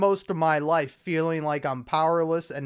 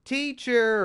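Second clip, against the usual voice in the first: put-on tattling kid; 8 semitones higher than usual. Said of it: "and he goes".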